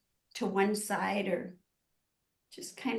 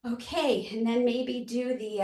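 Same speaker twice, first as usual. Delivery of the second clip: ordinary talk, nothing marked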